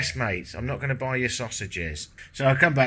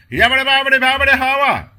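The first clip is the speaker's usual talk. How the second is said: much higher, louder mock street-vendor yell; an imitated street hawker yelling through the window; imitating street shout; 12 dB louder than usual. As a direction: belt it out like the man outside. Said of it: "your man started shouting".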